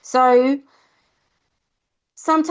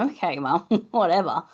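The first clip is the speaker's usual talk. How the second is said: put-on kid dismissing mum; 4 semitones lower than usual. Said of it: "I go".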